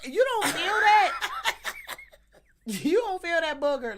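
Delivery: high, loud laughing disbelief. Real, not acted. Telling friends about a giant booger, laughing.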